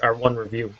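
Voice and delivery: normal talking voice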